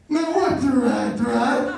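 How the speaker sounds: slow, goofy waiter voice; silly mock-waiter voice; stupid-grinning waiter. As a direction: draw it out through a big stupid grin. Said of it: "give you this stupid grin".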